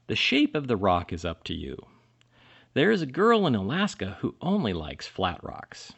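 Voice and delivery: ordinary delivery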